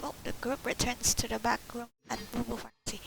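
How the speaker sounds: usual speaking voice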